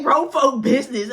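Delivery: loud, high-pitched disbelief; incredulous echo. Retold or imitated. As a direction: throw it back loudly in disbelief. Acting out her incredulous reply.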